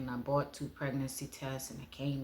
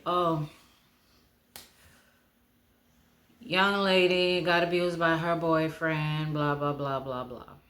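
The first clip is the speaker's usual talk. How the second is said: mocking gossip; 3 semitones higher, 8 dB louder than usual. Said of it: "what people would say".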